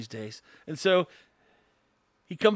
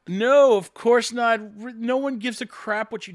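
emphatic dismissal; 6 semitones higher, 6 dB louder than usual. Real, not acted. Host scoffing at his Putin scenario, emphatic dismissal. Answers his own mocking question.

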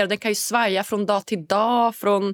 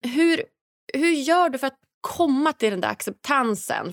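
ordinary delivery

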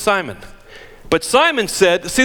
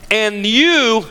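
"you" lifted and pressed as singular; stress on you; 7 semitones higher, 3 dB louder than usual. Shown as a demonstration. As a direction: hit the word "you", meaning one person.

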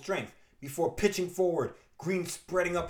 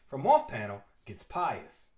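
normal talking voice

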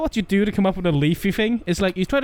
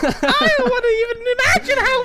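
Nostalgia Critic screaming. Acted. Mocking a reviewer, screaming his insult in a high voice. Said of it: "And then Doug Walken goes".

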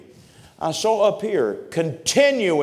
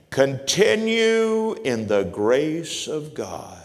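drawn-out reading; much slower than usual. Shown as a demonstration. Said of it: "stretched scripture word".

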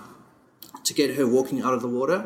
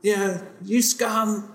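retold heckler; 7 semitones higher, much slower than usual. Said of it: "criticized by people holding".

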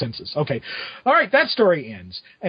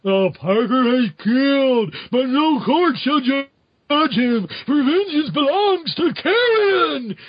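slow, high, drawn-out zombie villain; Carrion; 7 semitones higher, slower than usual. Retold or imitated. Carrion in the final panel vowing vengeance, mock zombie wail. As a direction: wail it out like an undead avenger. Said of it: "then we get the final".

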